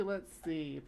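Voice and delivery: usual speaking voice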